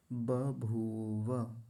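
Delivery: 'बभूव' very slowly, ba-bhoo-va; final 'va' fully voiced in 'बभूव'; distinct ब and व. Shown as a demonstration. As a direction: stretch each syllable of 'बभूव'. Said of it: "पहले है ब और फिर है व".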